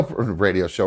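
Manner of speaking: usual speaking voice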